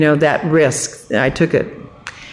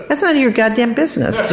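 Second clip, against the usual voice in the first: higher-pitched indignant rebuff; bad attitude; 7 semitones higher than usual. Woman rebuffing the guard at her door, indignant. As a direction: tell a nosy neighbor off. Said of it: "that's my attitude".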